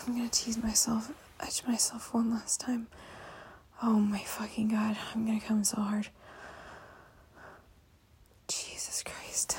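usual speaking voice

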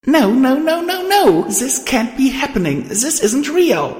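very high, panicked cries of denial; anguished shout. A narrator's reading. Star denying the death beside the body, shouting. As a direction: shout it in high-pitched desperation. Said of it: "I shouted inside my head".

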